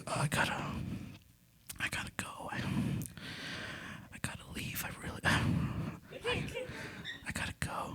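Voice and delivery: breathy, unvoiced whispering; sheepish whisper. Teen sneaking out at dawn to the older woman, whisper. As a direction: sneak the words out, trying not to be heard. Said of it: "And I was like".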